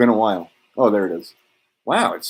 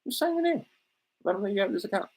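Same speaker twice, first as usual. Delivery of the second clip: very high, hammed-up sugary voice of a generous uncle; high and wheedling, like promising everything for free; Uncle Sam's offer; 13 semitones higher, faster than usual. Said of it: "He said".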